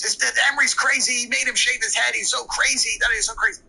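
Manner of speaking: high, indignant imitation of mom; mom retelling. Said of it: "the story she recited".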